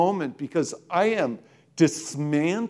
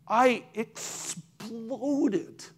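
voice breaking; 3 semitones higher, 5 dB quieter, much slower than usual. Real, not acted. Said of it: "I exploded into tears".

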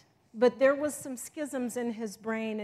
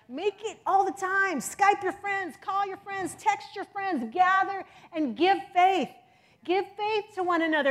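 rousing exhortation; 8 semitones higher, 8 dB louder than usual. Acted. Urging the congregation.

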